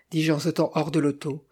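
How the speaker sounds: ordinary delivery, nothing marked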